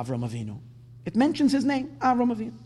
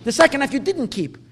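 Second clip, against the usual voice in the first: higher and louder, protesting to God; pleading complaint; 6 semitones higher, 8 dB louder than usual. Pretend. Avram complains to God about the broken promise, loud and higher. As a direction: voice Avram's protest with rising force. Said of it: "It could have said".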